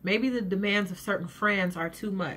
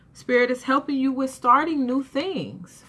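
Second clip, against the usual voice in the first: usual speaking voice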